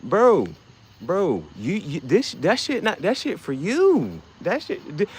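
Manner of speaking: imitating a caught liar tripping over words; flustered sputter. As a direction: sputter like you've been caught out. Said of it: "and you're just like".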